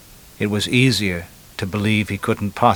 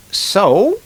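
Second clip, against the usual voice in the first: high, louder lady's retort; lady in a joke; 11 semitones higher, 6 dB louder than usual. Acted. Lady on the beach retorts to the guy, high mock lady voice.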